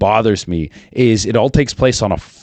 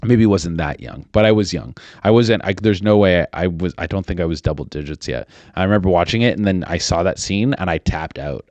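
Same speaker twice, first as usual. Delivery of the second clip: ordinary talk, nothing marked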